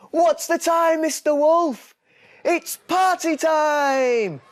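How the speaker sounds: very high, loud cheering shout; surprise shout. A narrator's reading. Friends jumping out to surprise the wolf, shouting. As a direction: yell it like a surprise party.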